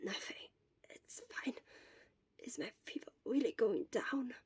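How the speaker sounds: breathy sick whisper, barely there; fading words of someone feverish; weak whisper. Acted.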